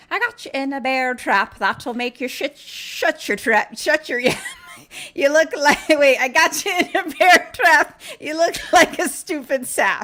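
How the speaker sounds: high, loud sing-song leprechaun rhyme; laughing leprechaun taunt. Retold or imitated. Leprechaun mocking trapped Nathan, high and loud. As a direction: taunt him like a gleeful little imp. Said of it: "he's, like, laughing and he's like".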